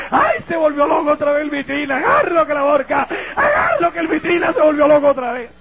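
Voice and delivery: alarmed high shouting crowd. Retold or imitated. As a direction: yell in panic to grab him. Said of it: "y comienzan a gritar".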